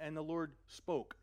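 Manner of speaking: usual speaking voice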